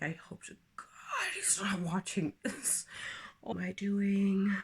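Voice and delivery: hushed nervous aside; whispered aside. Real, not acted. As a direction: whisper it like a secret. Aside hoping ex isn't watching.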